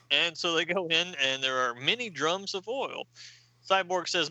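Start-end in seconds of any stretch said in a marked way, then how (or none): none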